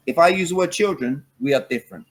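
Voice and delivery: normal talking voice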